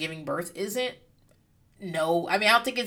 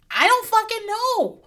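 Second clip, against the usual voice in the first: much higher, louder and slow; exasperated cry; 7 dB louder, slower than usual. Real, not acted. Telling listeners she was clueless, high exasperated outburst. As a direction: throw up your hands, baffled.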